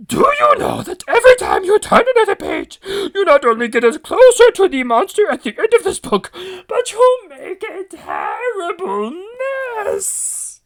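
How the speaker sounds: high, loud whining Grover. Acted. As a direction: scold the reader like a flustered monster. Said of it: "my Grover".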